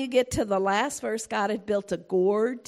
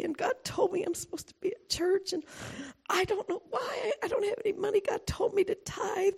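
higher, hushed, half-whispered whining; mock confused churchgoer; 8 semitones higher, 4 dB quieter than usual. Churchgoer to the pastor's wife, mock hushed whine. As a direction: play a flustered believer making excuses.

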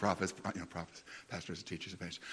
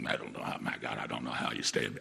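much lower, grumbling "my God" disgust; rude version; 6 dB quieter than usual. Shown as a demonstration. The rude way he did not call the company. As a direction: growl it out like an exasperated customer. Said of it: "I didn't say it like that".

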